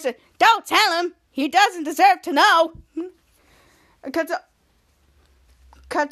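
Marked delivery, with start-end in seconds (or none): 0.4-2.7 slightly raised, louder character voice; slow, lightly pitched-up command; high-pitched voice. Acted. Villain voicing his boss, lightly high-pitched. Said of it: "in a high-pitched voice".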